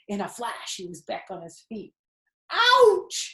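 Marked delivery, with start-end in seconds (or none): 2.5-3.3 sharp, very high, loud yelp of pain; scream. Acted. Wilbur screaming as the rat bites his tail. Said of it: "he screamed".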